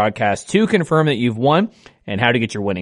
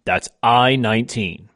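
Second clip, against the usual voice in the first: slow, deliberate number calling; bingo caller; slower than usual. Acted. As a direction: call each ball slowly with falling ends. Calls each bingo ball twice.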